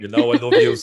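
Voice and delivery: playful loud gush; excited. Real, not acted. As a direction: gush at the guest. Host teasing guest warmly.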